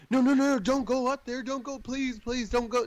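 high-pitched mock begging, burst of urgency at the start; panicked pleading. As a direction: imitate a frantic partner begging someone to stay. Acting out a frightened partner's plea.

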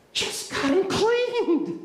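high, strained, drawn-out complaint; exasperated protest. Acted. Tired fisherman objects to going out again, exasperated. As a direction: protest slowly at a high pitch. Preacher acts Simon's weary protest.

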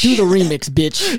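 mock bossy demand. Inmate demanding a song.

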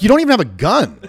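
high, loud scoff; retold shout. To cops without guns, a loud mocking shout. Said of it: "I'm like".

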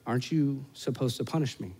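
soft, tentative question to Dad; lightly voiced wary little boy, hushed; cautious child. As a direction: ask it quietly, unsure what is coming. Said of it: "he's still a little cautious".

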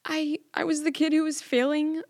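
high, tearful, squeaky voice. Real, not acted. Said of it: "let me have my squeaky voice pass".

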